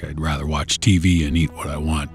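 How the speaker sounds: ordinary delivery, nothing marked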